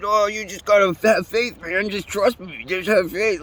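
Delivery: high, drawn-out imitation of his coaxing; mock-pleading passenger. Passenger asking the driver for faith, mock-pleading.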